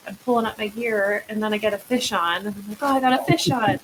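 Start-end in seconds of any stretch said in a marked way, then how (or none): 2.8-3.8 higher, louder alarmed exclamation; retold panic. Angler with a fish on near a whale, retold panic. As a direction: re-enact a flustered cry for help.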